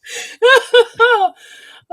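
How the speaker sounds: high burst of laughter; loud laugh. Real, not acted. Cracks up at the bit.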